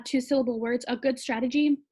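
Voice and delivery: ordinary delivery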